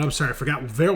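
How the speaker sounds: ordinary talk, nothing marked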